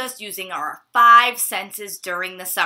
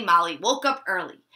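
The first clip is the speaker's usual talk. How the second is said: ordinary delivery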